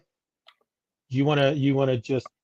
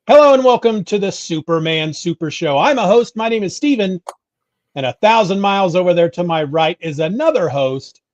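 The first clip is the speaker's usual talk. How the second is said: higher, louder announcer read; podcast host intro; 6 semitones higher, 7 dB louder than usual. Acted. Host welcomes listeners to the Superman show, announcer style. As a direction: open the show like a radio host.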